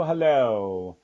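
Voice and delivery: echoing hello; hello with echo effect. Shown as a demonstration. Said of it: "It sounded like an echo effect on your voice".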